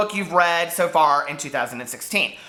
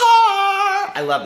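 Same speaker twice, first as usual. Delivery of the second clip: much higher and louder, slow and drawn out; thrilled squeal; 14 semitones higher, 6 dB louder than usual. Real, not acted. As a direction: gush over it like a delighted fan. Thrilled by the sneak peek.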